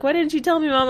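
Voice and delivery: put-on high, pleading teen whine at her mother; mock whiny Carrie. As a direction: whine at Mom like a wounded teenager. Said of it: "and then Carrie was like".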